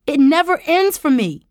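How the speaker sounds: high, anguished shout; booming. A narrator's reading. Tara tells uncle her pain goes on, booming shout. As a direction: shout the hurt out loud. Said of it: "Tara boomed".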